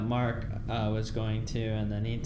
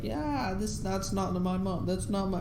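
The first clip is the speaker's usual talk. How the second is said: Mark denying; 7 semitones higher than usual. Retold or imitated. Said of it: "And then Mark's like".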